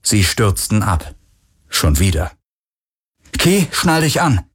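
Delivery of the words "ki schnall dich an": higher, drawn-out urgent command; raised-pitch call of alarm; urgent order. A narrator's reading. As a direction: order her to brace for the crash. Urgent order as ship falls.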